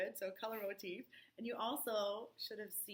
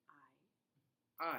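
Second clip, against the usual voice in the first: breathy whispered aye; whispering; 5 semitones lower, 4 dB louder than usual. Acted. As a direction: whisper the answer. Whispered reply.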